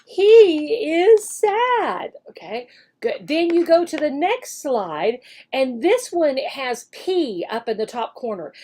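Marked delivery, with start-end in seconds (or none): 0.1-2.1 slow, high and loud model line; drawn out so the child can copy; slow modeled sentence. Shown as a demonstration. Teacher shows what the student should say, slow and high. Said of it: "and say".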